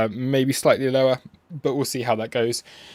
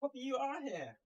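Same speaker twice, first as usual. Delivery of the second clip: much higher and much softer, cooed to the pet; cooing to the dog; 16 dB quieter than usual. Real, not acted. Streamer softly greeting Poppy the dog, high hushed coo. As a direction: hush your voice right down to greet the dog.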